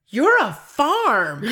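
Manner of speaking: imitating the customer. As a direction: stretch it out like a puzzled stranger. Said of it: "he goes".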